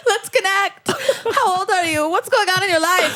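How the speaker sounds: high, whiny pretend crying; mock tearful. Crying teen clinging to the driver she hit, mock tearful. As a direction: play a needy teen wailing through small talk. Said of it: "I'd immediately be crying".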